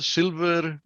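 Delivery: ordinary talk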